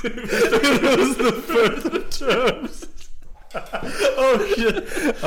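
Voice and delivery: high slow laughing; helpless laughter through the words. Real, not acted. Laughing fit.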